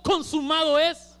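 "Consumado es" lento, más fuerte, con "es" subiendo; grito de victoria. Pretend. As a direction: proclamar "Consumado es" despacio y con triunfo. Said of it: "pero él gritó". (Jesús proclama en la cruz, lento y fuerte)

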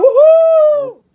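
normal talking voice

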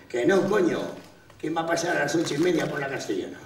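mock-indignant cocky retort; irritated dismissal. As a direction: brush off the warning impatiently, gathering speed. Conductor replica al copiloto que avisa.

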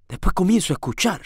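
ordinary delivery

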